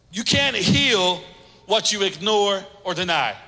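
ordinary delivery

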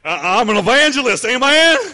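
loud, showy televangelist preaching; TV evangelist voice. Shown as a demonstration. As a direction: ham it up like a TV preacher. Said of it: "your best T B N voice".

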